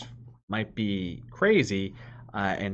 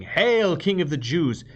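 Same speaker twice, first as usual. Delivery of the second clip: higher, louder, jeering salute; mocking hail; 9 semitones higher, 8 dB louder than usual. Soldiers jeer at Jesus, loud mocking hail.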